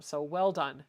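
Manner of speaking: ordinary talk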